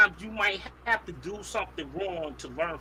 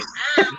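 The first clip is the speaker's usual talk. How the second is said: short loud laugh; quick hearty laugh; burst of laughter; 4 semitones higher, 6 dB louder than usual. Real, not acted. Group laughing at remark.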